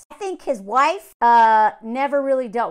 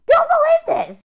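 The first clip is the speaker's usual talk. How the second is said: much higher and louder, emphatic; emphatic warning; 7 dB louder than usual. Real, not acted. Vlogger warning viewers not to buy his promise, emphatic.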